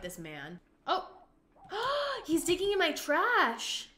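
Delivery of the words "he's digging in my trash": shocked outrage. Real, not acted. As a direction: cry out at an intruder. Intruder seen in her trash.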